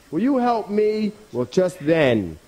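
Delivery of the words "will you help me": high-pitched rabbit plea; pleading rabbit. Acted. Rabbit still waiting on the dog's help, pleading. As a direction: lift the voice right up to beg. Rabbit asks the dog again.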